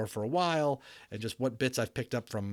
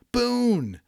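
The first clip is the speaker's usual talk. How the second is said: sudden gasp of a name; 13 semitones higher, 6 dB louder than usual. A narrator's reading. Omri realizes Boone is hiding.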